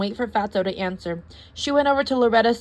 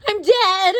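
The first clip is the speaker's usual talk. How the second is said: dramatic wail; 11 semitones higher, 7 dB louder than usual. A narrator's reading. Dramatic wail after falling.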